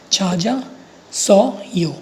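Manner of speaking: छा जा, pause, सो यो, each syllable separate. Shown as a demonstration. Teacher building the word for learners, syllable-by-syllable छा जा सो यो. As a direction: spell out छा जा सो यो in slow separate syllables. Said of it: "चा बन जाएगा और जा बन जाएगा".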